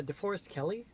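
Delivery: normal talking voice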